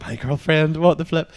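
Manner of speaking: high, loud mock protest; hammed-up indignant outburst; retold outrage. Seeing the singer's arm round girlfriend, mock outrage.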